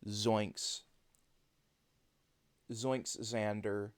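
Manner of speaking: slow, voiced "Zoinks, Zoinks". Shown as a demonstration. Streamer teaching voiced versus unvoiced sounds. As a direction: buzz the Z of "Zoinks" in the throat. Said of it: "Whereas if you say".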